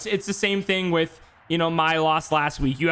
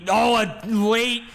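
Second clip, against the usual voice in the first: raised, drawn-out commentator exclamation; excited, slower live call; surprised play call; 5 semitones higher, slower than usual. Real, not acted. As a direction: stretch it out as the blitz surprises you. Calls a surprise blitz live.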